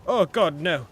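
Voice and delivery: alarmed exclamation at a horrible sight; dismayed outcry. Real, not acted. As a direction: recoil and cry out loudly. Reacts to horrible thing in game.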